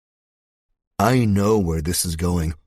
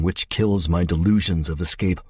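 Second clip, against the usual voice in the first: usual speaking voice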